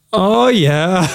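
a cheerful put-on Canadian accent. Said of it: "That's Canadian".

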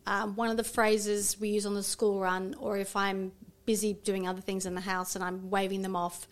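usual speaking voice

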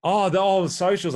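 high, loud amused realization; oh, catching on with a laugh; amused surprise. Real, not acted.

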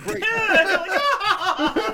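high, loud laugh over the words. Real, not acted. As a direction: burst out laughing as you say it. Echoing the joke, cracking up.

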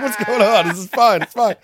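high, loud feigned innocence; mock innocent protest. Protesting innocence to the police about the bear, high and loud. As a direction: play dumb to the cops, squeaky and loud.

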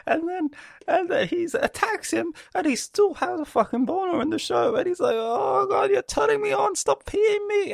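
very high squeaky voice, choked with laughter; cracking up mid-story; helpless laughter. Real, not acted. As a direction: barely get it out through laughing. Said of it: "I don't know why I'm laughing".

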